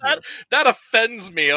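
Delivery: hammed-up offence at the movie; mock offended. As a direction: play up being personally offended. Retold offended reaction.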